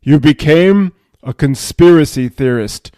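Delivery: loud, booming verdict. Acted. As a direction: pronounce it forcefully, like a judge. Higher self repeats verdict, booming.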